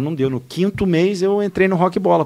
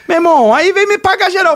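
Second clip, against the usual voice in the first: high, loud indignant shout; mock outraged yell; 12 semitones higher, 5 dB louder than usual. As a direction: yell it like the stunned coworker. Colega gritando indignado. (grito indignado e debochado)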